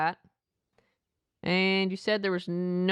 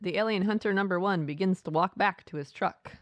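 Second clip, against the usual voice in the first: ordinary talk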